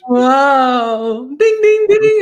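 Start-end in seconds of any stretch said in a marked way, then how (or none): 1.4-2.2 mock bell ding. Celebration bell chant.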